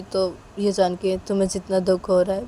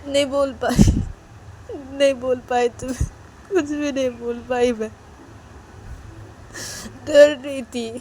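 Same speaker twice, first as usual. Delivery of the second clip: emotional confession; 5 semitones higher, 3 dB louder than usual. Acted. Riya confesses she was afraid.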